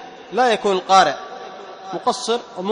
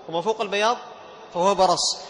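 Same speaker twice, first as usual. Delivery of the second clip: ordinary talk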